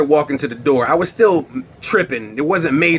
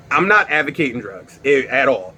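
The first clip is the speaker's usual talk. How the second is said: ordinary talk, nothing marked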